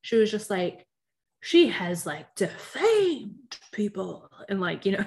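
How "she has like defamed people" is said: mock Old South drawl, key word drawn way out; Old South accent. Imitating a castmate's weird Old South accent. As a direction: stretch it slowly in a put-on Old South accent. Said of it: "like, Old South accent".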